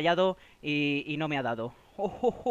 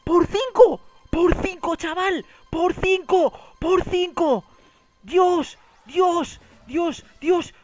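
much higher and louder screaming with joy; ecstatic shouting; 9 semitones higher, 8 dB louder, slower than usual. Real, not acted. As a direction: yell every multiplier like you just won big. Huge multiplier win on stream.

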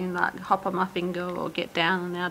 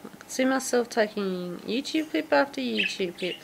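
ordinary talk, nothing marked

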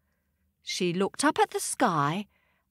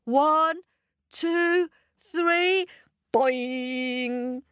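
counting to a leap; 6 semitones higher, much slower than usual. Shown as a demonstration. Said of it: "Get ready to leap".

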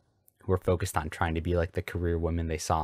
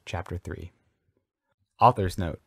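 plain reading voice

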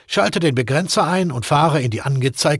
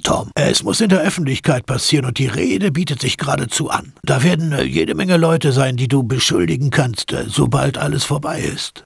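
ordinary talk

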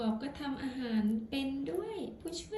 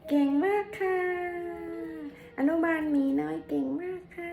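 cheerful praise; 5 semitones higher, 10 dB louder than usual. Real, not acted. Mother cheering her toddler.

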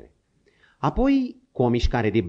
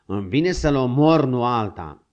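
furious; 6 dB louder than usual. A narrator's reading. Furious at the horse.

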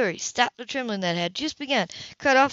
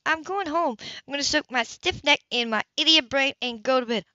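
higher, tart woman's voice; angry woman; 6 semitones higher than usual. Acted. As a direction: snap back at him, higher. Grace storms off.

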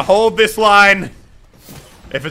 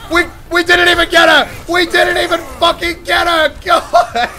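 laughing yell; 8 semitones higher than usual. Real, not acted. Plan failed in game.

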